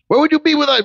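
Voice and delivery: high rhetorical challenge; rising preaching cry. Real, not acted. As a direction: fling the question at them.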